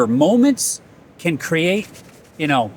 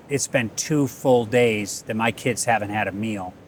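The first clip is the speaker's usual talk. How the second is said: sad, through crying; 6 semitones lower, 5 dB quieter than usual. Retold or imitated. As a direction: lower and softer, a retold tearful plea. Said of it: "sad, through crying".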